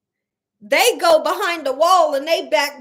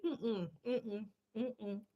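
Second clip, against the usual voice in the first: muffled no; 5 semitones lower, 19 dB quieter, much slower than usual. Real, not acted. Refusing, humming no.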